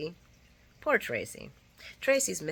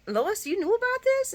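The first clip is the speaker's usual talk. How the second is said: high-pitched "you knew about this?"; shocked accusation; 4 dB louder than usual. Retold or imitated. Ned confronting Lois about the plan: high shocked question. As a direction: turn on her in disbelief.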